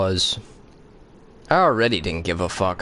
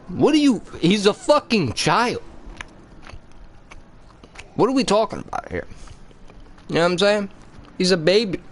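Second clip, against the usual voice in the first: outraged disbelief; 11 semitones higher than usual. Real, not acted. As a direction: rant in total disbelief. Outraged rant about baby character.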